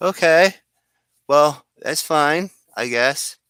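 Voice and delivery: high, drawn-out grudging agreement; reluctant acceptance. Acted. Newcomer told he'll lose some memory, reluctantly agreeing. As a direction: start loud and high, then ease off into resignation. Acted reply to losing his memory.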